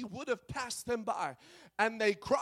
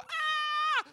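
sudden high scream of fright; terrified scream. Retold or imitated. Disciples in the boat seeing Jesus, screaming in fright. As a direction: shriek like men seeing a ghost. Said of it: "and they go".

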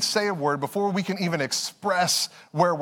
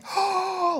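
excited gasp; 13 semitones higher than usual. Retold or imitated. Toddler sees his toy.

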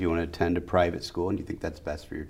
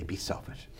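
breathy, much softer whisper of the lesson; hushed advice; 11 dB quieter than usual. Shown as a demonstration. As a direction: drop to a whisper to land the point. Father's lesson, hushed punchline.

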